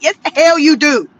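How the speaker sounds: normal talking voice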